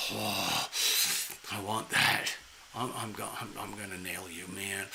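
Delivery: a lusty tomcat muttering low to himself; lustful male cat. Retold or imitated. Guys drawn in by a woman's pheromones, hushed low mock lust. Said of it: "They're like, just like".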